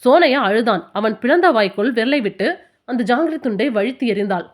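usual speaking voice

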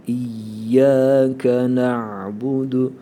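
İyyâke na'budu chanted slowly, y flowing; slow recitation. Shown as a demonstration. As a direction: recite it drawn out like a Quran reciter. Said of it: "Ye'yi de biraz akıtacağız".

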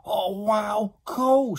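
put-on high, loud children's cheer; excited shouting. Children get free toys, excited shouting. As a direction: shout it in awe and glee. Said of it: "shouted the children".

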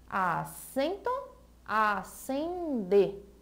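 "Acento, acender" stretched slowly, each syllable drawn out; slow and paused. Shown as a demonstration. Teacher showing pupils how alike "Acento" and "acender" sound, slowly. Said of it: "quando nós falamos devagar, bem pausado".

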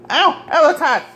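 sudden high-pitched ouch; real pained yelp. Real, not acted. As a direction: yelp as if you just touched something hot. Burns himself on hot food.

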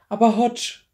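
high, loud plea; desperate cry. Acted. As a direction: cry out in despair. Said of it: "rief Clary verzweifelt".

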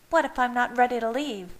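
high, quick, defiant retort; hissed. A narrator's reading. Leah defies Jasper pushing her out, hissing. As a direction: challenge them with a quick hiss. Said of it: "hissed Leah".

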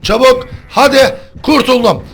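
high, loud frantic yells; frantic shouting. Real, not acted. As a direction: yell in panic, then plead. S0 escaping, then hooked by killer. (panik içinde yüksek sesle)

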